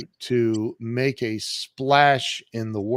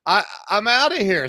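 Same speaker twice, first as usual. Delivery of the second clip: higher, louder fed-up declaration; indignant exit; 8 semitones higher, 5 dB louder than usual. Retold or imitated. Quitting the job after the manager's insult, fed up. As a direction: announce you're leaving, fed up.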